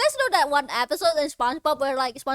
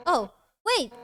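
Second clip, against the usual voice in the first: ordinary talk, nothing marked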